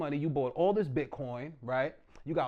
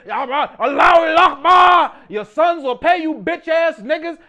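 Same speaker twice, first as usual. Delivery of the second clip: loud, high cursing shout; hammed-up furious defiant yelling; Saddam Hussein; 9 semitones higher, 16 dB louder, slower than usual. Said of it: "he was cursing".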